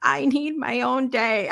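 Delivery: lightly mocking a crying daughter; mock crying child. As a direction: imitate a little girl in tears. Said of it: "she's crying".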